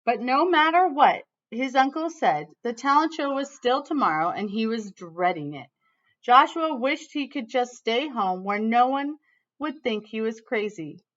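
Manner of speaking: lively read-aloud storytelling voice; reading to children. Acted. Teacher reading a picture book to her class, lively storytelling.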